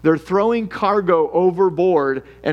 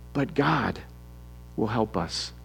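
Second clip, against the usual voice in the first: lower, softer, settled; quiet reassurance; 5 semitones lower, 9 dB quieter than usual. Acted. Preacher answers money worries, hushed. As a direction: let the answer land gently.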